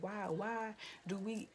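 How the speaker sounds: usual speaking voice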